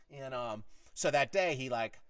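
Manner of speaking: ordinary delivery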